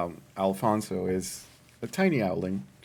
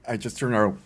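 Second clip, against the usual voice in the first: ordinary talk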